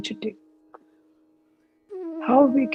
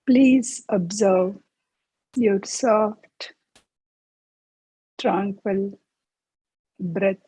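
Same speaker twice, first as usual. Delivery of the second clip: guided meditation. Acted. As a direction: guide them gently into each breath. Leads a breathing meditation.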